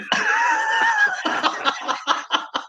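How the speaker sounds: long high-pitched laugh; laughter. Real, not acted. Storyteller reaches the lion photo punchline, helpless laughter. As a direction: crack up at your own story.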